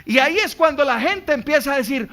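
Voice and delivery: ordinary talk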